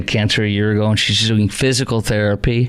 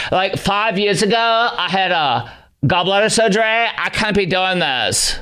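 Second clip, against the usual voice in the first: higher mock complaining voice; whining woman impression; 6 semitones higher than usual. Host mimicking woman pulling cancer card, whiny. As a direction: imitate her pleading, dragging the end. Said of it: "you want me to do the impression".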